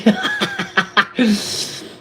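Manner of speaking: a sudden, high-pitched laugh; high burst of laughter. Real, not acted. Laughs at a chat comment.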